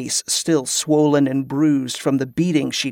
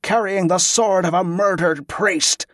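high, accusing bark; 4 semitones higher than usual. A narrator's reading. Lieutenant priest denouncing Tezcah to pilgrims, high bark. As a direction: bark out a public denunciation. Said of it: "the lieutenant barked".

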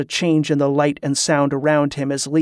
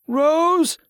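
high-pitched wail; tearful wail; 13 semitones higher than usual. A narrator's reading. Delirious man crying for his beloved, high tearful wail. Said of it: "Tesca wailed, tears streaming from his eyes".

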